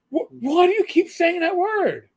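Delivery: high, loud mock-shocked mother; imitating shocked mother. Son imitating his mother scolding him at dinner, high shocked voice. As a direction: squeak it out like a scandalized mom.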